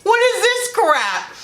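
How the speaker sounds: high, slow, laughing mock outrage; fed-up friend. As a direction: drag out the outrage through a laugh. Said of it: "She goes".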